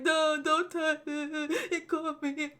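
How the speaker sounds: high, slow whimpering kid imitation; hammed-up tearful protest; mock crying. Imitating a bullied kid at school, mock crying. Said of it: "when the kids cry".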